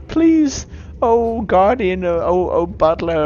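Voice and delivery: high, loud supplication; pleading worshipper. Acted. Worshipper appealing to the god's guardian, pleading. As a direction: beg grandly, drawing out the titles.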